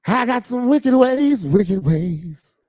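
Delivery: high, loud, slow line for the congregation to echo; slow, high, loud call to repeat; preached for repeating. Shown as a demonstration. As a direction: give it out for them to say back. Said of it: "Come on, say it like that".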